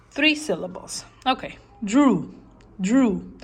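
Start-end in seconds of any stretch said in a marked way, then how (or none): 1.8-3.3 "drew" said as one clean beat; single mouth opening on "drew"; syllable demo. Shown as a demonstration. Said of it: "one vowel".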